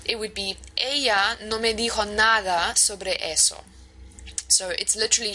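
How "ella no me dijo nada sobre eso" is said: teacher reads the Spanish original to the class, in fluent Spanish; spoken Spanish. Shown as a demonstration. Profesora lee la frase en español con pronunciación nativa. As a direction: read the Spanish example sentence in clear native Spanish. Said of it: "a translation of Spanish".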